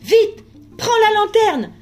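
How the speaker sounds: high, loud desperate call; shouting for help. A narrator's reading. Boy calls to the ghost girl for the lantern, shouting. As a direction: yell her name across the street in panic. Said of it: "cria de nouveau Sacré-Cœur".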